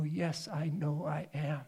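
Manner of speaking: understated serene confidence; calm quiet answer. Acted. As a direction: answer quietly and calmly, totally sure. Jesus answering the tempter calmly.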